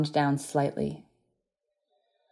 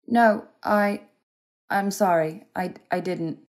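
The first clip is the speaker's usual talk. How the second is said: flustered apology; 3 semitones higher, slower than usual. A narrator's reading. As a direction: stumble into saying sorry, breaking off. Stammered apology to wounded man.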